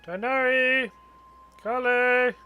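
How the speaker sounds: loud, high, drawn-out call. Pretend. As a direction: call out their names, holding the last vowel. Streamer calls out to game characters.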